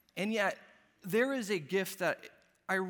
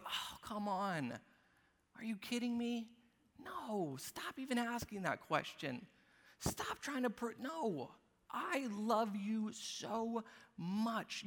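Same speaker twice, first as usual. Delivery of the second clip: soft, much quieter, slowing to a hush; tender heavenly Father; 3 semitones higher, 5 dB quieter, slower than usual. Pretend. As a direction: coax away the doubt with gentle love. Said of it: "He looks at you ... He goes".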